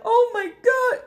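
high, drawn-out fake wail; exaggerated high-pitched sob-shout; mock crying. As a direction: wail it like a hammed-up crying video. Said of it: "a video of me crying".

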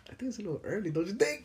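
a high, mock-skeptical boss; high mocking manager. Manager doubting the early request, in a high teasing voice. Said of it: "He's like".

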